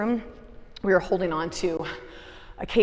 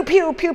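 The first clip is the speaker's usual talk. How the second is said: pew pew sound; 9 semitones higher, 6 dB louder than usual. Retold or imitated. Describes sons' toy gun noises.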